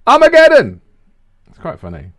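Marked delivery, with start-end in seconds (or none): 0.0-0.8 high, loud cry of the safe word; big mock yell of Armageddon; mock radio shout. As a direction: bellow it like a radio host losing it. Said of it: "trying his best not to laugh".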